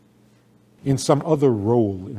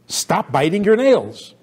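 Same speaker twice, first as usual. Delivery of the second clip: put-on higher, slow scolding of a child; irritated adult; 7 semitones higher, 3 dB louder, slower than usual. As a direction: scold like an irritated parent, drawing it out. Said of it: "You sound like an irritated adult".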